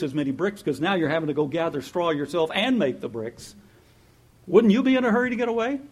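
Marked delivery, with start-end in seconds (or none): none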